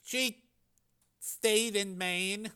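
quiet, slow answer held back by grief; said quietly. A narrator's reading. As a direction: let the grief hush the words. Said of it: "Frank said quietly".

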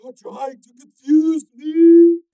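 high, slow loud growl; strained warning. A narrator's reading. Stalker warning Steel, slow and strained. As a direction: hold him back, slowly.

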